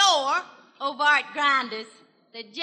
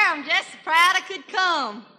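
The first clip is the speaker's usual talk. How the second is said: ordinary delivery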